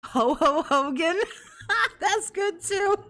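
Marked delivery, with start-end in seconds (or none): 0.1-1.2 higher, laughing Santa ho ho; mock Santa ho ho. Joking with the co-host, a playful Santa ho ho. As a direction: chuckle it out like a jolly Santa.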